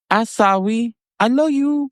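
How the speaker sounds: squeaky high-pitched declaration; high silly voice. Retold or imitated. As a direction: sing it out in a goofy falsetto. Quoting Maurice, high voice.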